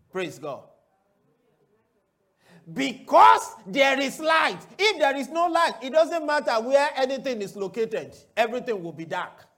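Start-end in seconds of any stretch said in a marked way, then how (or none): 2.7-6.5 full-force pulpit cry; preaching shout. Real, not acted. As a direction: shout it out to the church. Preacher's climactic answer.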